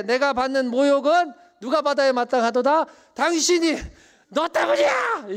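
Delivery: wife yelling at her husband, rising to a shriek; angry shout. Acted. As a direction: scream the blame at him. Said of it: "지금 막 소리치는 겁니다". (남편에게 소리치는 아내, 화난 외침)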